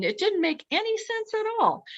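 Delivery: high-pitched bafflement; baffled. Real, not acted. Storyteller recalling the impossible smoke, high and baffled. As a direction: pitch it way up in disbelief.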